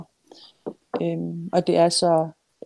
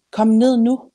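raised, higher command; urgent call; 5 semitones higher, 9 dB louder than usual. A narrator's reading. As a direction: call the child down firmly. Grandmother orders child down, read aloud.

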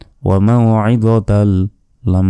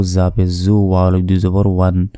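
usual speaking voice

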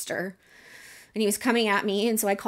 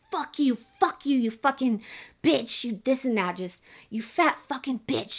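imitated screaming abuse; 6 semitones higher than usual. Woman imitating her drunk ex screaming at her, mock scream. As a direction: mimic his screaming insults, pitched up, not at full volume. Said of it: "He was screaming at me".

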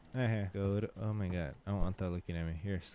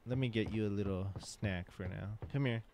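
normal talking voice

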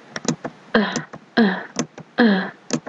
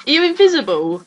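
usual speaking voice

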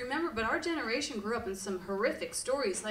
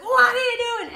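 much higher, louder mock yell; fire chief's yell; 11 semitones higher, 9 dB louder than usual. Fire chief bursting in on screaming kids, mock yell. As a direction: bark it like a furious neighbor.

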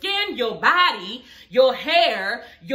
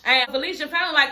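usual speaking voice

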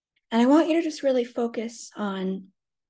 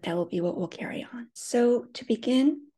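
normal talking voice

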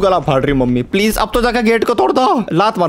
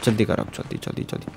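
hurried whisper, softer, barely voiced; urgent whisper; 8 semitones lower, 12 dB quieter, faster than usual. Real, not acted. Gamer rushing to shut the door before dad comes, urgent whisper. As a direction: hiss it out, don't get caught.

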